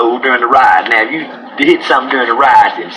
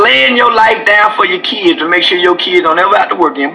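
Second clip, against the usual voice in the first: normal talking voice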